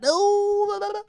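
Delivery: very high, loud squealing; excited squeal. Pretend. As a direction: squeal with mock glee.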